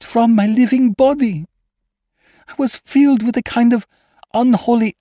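high, strained voice of a haunted lady; frightened noblewoman. Acted. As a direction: tell it as a woman on the edge of madness. Possessed woman recounts her dream.

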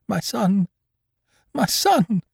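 high, trembling cry of a mother; stammering in terror. A narrator's reading. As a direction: cry out the name in panic. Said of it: "she stammered".

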